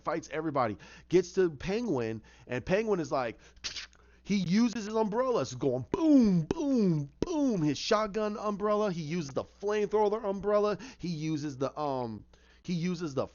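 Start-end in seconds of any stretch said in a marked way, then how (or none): 5.9-7.6 drawn-out, high falling booms; imitated gun booms. Storyteller mimics Penguin's shotgun umbrella, booming high. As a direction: fire off long mouth-made blasts.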